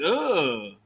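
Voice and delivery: low mock ugh of disgust; imitated disgusted ugh. Streamer imitates her reaction to the bottle, low mock disgusted ugh. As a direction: groan it out like the smell hit you. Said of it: "She said".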